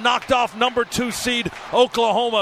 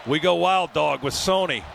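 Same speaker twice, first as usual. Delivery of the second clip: normal talking voice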